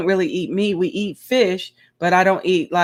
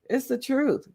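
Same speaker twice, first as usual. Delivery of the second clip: ordinary delivery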